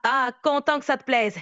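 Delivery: light Québécois French accent; Quebec accent. Real, not acted. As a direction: speak with a mild Quebec lilt. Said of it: "arrêter avec l'accent québécois".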